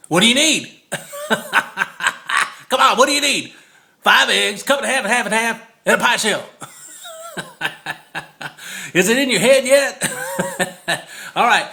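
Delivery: giddy laughing rundown; laughing teasing. Real, not acted. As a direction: quiz the viewer playfully, cracking up. Teasing quiz of the recipe.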